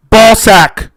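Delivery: loud prank scream. Pretend. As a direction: yell it to shock the room. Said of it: "I'm just screaming that".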